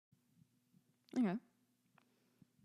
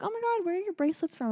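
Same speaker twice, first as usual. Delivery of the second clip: high, rapid small-talk voice; chirpy hygienist; 11 semitones higher, faster than usual. Retold or imitated. Dental hygienist making small talk with a scared patient, chirpy imitation. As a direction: imitate an over-chatty nurse, pitched way up.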